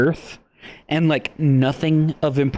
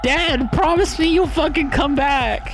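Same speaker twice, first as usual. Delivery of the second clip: high, whiny mock-kid voice, much higher than usual; mocking whiny daughter; 8 semitones higher than usual. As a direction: parody a sulking kid begging her dad. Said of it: "Murph is all like".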